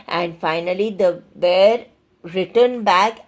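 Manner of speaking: ordinary delivery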